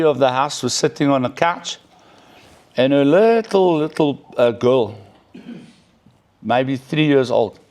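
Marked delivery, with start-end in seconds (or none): none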